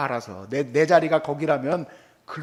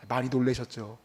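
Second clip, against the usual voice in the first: soft consoling; 7 semitones lower, 8 dB quieter than usual. Retold or imitated. Bowing to other driver.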